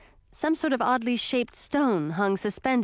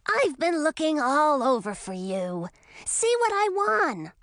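put-on eager boyish dragon, the last words pitched higher and slowed; young dragon voice; 5 semitones higher than usual. A narrator's reading. Young dragon shows his friend Emma his prize, eager boyish voice. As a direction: show off a prize like an excited kid. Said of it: "said the voice of an adolescent dragon".

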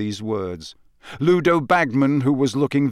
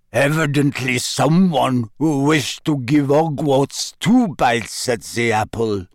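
grand French headmistress voice; haughty French lady; French accent; 4 dB louder than usual. A narrator's reading. Madame Maxime, French.

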